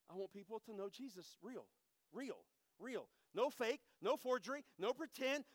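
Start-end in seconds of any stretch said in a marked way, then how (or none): 0.1-3.1 soft earnest repetition. Real, not acted. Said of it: "soft repeated emphasis".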